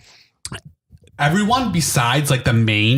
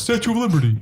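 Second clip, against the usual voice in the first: put-on higher-pitched caricature New York accent; mock New York accent; 6 semitones higher, faster than usual. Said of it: "that's how New York people talk".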